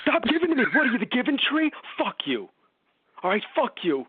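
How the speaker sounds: high, exasperated shouting; Terry snapping at the comforting tree, high angry yell. Retold or imitated.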